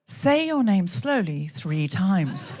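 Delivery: slow, drawn-out assistant voice; Alexa seducing. Retold or imitated. Alexa flirting with the comedian's husband, slow seduction. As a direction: draw each word out slowly, like a flirting Alexa. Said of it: "She said".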